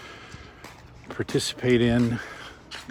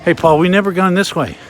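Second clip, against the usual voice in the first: loud, higher, quick call to a companion; 7 semitones higher, 10 dB louder, faster than usual. Real, not acted. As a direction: call it out to your friend, quickly. Calls to companion on wharf.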